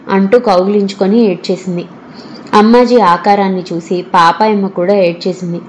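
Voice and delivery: ordinary delivery, nothing marked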